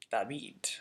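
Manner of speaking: David softly as "dah-BEED"; Greek-style David, "dah-BEED"; Greek pronunciation. Shown as a demonstration. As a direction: say David with b for v and stress on the ee. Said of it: "we would know that it's David".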